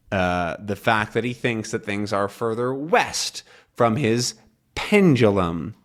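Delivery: ordinary delivery, nothing marked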